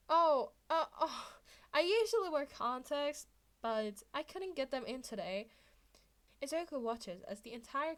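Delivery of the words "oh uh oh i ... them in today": feigned innocence. Acted. Izuku repeats innocent excuse.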